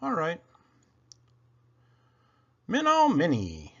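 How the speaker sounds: ordinary delivery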